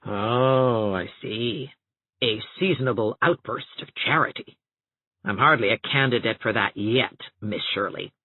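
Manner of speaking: low, dry sarcastic voice. A narrator's reading. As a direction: sneer at the charity. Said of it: "The sarcasm came then".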